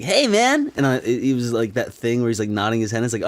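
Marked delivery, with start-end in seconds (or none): 0.0-0.7 high, loud put-on greeting; mock his greeting, pitched way up; exaggerated imitation of a pal; imitating a stoner friend. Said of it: "'cause he's like".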